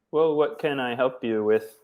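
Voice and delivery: normal talking voice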